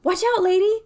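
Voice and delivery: high, loud warning yell; retold shout. Shouting at her mom about to bump a stranger, loud warning. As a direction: call out sharply to warn her. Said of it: "but I said".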